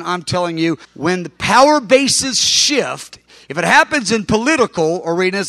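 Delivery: ordinary talk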